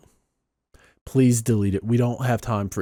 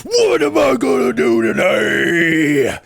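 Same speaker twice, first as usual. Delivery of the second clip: higher hammed-up boastful engineer, building to a shout; mock Mark Rober; 4 semitones higher than usual. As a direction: brag like a smug YouTube genius, then yell. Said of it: "and I'm like".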